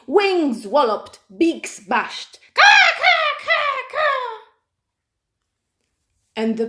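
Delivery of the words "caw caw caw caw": high loud bird caws; frantic high caws; squawking birds. Acted. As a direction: squawk like panicked puffins. Startled puffins squawk.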